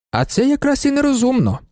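high-pitched excited comment; excited spectator. A narrator's reading. Racegoer commenting as the horses run, excited. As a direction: pitch it up like an onlooker.

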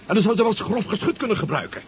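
anxious rising voice; urgent worry. Acted. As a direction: push the danger at him. Worried about the monsters' warning.

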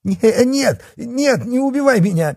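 very high panicked begging; squeaky cry. A narrator's reading. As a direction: squeal for your life, stammering. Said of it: "пискляво закричал полицейский".

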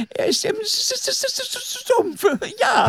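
high nervous stutter; stuttering lie. Acted. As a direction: stammer out a lie. Snake lies, stuttering.

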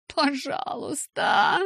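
a slow, drawn-out groaning please; Rose, head in hands, moans a plea to the guards; moaning plea. A narrator's reading. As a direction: moan it in anguish, stretched out. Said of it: "простонала я".

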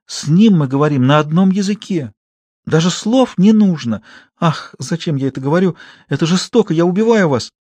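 milk the parting scene; tragic actress. Acted. Actress Klara plays a farewell to her husband, high and theatrical.

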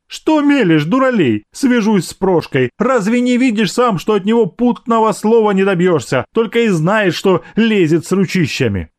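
high-pitched, indignant maid; scolding maidservant. Acted. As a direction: scold him as an exasperated servant woman. (ворчливая служанка, высоким голосом)

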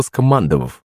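plain reading voice

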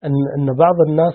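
usual speaking voice

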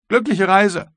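high, loud send-off; called farewell. A narrator's reading. Guard officer wishes the captain a good journey, high and loud.

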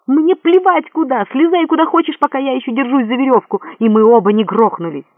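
high, loud, frantic scolding; exasperated outburst. A narrator's reading. Man holding the rope to climber on his shoulders, exasperated. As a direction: snap at him in rising panic. Quarrel while hanging on a rope.

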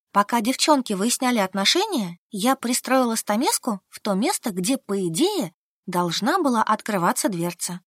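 usual speaking voice

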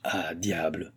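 quiet, subdued exclamation; hushed oath as doubt sets in; shaken disbelief. A narrator's reading. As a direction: say it softly, as if to yourself. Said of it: "ébranlé dans ses principes d'incrédulité".

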